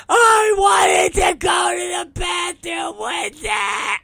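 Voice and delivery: high, drawn-out, wailing tantrum; screaming child. Retold or imitated. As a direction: imitate a kid bawling at the top of the voice. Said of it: "everything that Major is screaming about".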